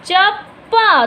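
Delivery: "चप्पा" broken at the double प, slow; slow, emphatic "चप्-पा"; sounding out. Shown as a demonstration. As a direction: dictate "चप्पा" in two weighted syllables. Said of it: "प का द्वित्व व्यंजन बनाना पड़ेगा".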